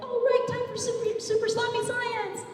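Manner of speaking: put-on high, big kids' science show host call. Host hyping the crowd for the science show, high and bright. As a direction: announce it like a game show host. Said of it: "Sometimes I was the show host with the microphone".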